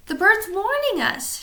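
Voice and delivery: high pirate shout; pirate warning his crewmate. A narrator's reading. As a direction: yell it as an urgent warning. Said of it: "shouted Pinky".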